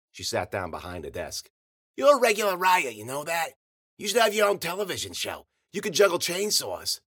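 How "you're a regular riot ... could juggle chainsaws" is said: put-on high-pitched, sarcastic old crone; frog-in-a-stovepipe voice. A narrator's reading. Old woman mocks the joker who insulted her, high and croaky. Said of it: "Ruby had a voice like a frog in a stovepipe".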